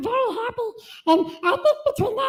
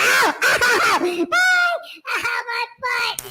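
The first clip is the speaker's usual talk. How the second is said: pained yelps; slower than usual. Acted. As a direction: yelp as if zapped. Tased in the butt.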